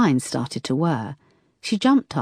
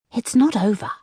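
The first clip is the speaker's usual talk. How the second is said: creepy caller; 8 semitones higher than usual. A narrator's reading. Caller threatens.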